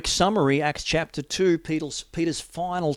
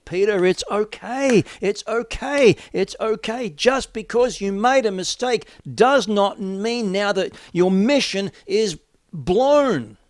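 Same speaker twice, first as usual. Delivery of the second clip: reassurance; 7 semitones higher, 7 dB louder than usual. Acted. Jesus reassuring Peter.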